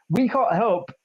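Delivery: usual speaking voice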